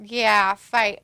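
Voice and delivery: ordinary talk, nothing marked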